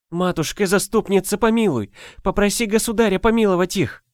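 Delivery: pleading. Acted. Peasant girl begging, sobbing.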